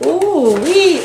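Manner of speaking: high-pitched gleeful whoop; excited. Real, not acted. Opening a friend's gifts, excited. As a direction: let out a delighted high whoop.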